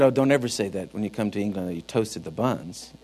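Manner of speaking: low, soft mock-scandalized caution; hushed retold warning. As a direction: imitate someone confiding an embarrassing warning. Retold scandalized warning from English friends.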